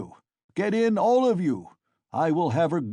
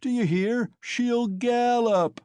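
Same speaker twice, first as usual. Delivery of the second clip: put-on drunken yelling; 7 semitones higher, 4 dB louder than usual. Drunken crowd and Mikolka yell.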